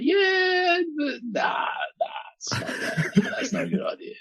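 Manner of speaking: high, drawn-out yeah sinking into a soft, laughing nah; hesitant, softened no with a giggle; polite refusal. Shown as a demonstration. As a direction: let them down gently, half laughing. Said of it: "it's like a polite way of saying no".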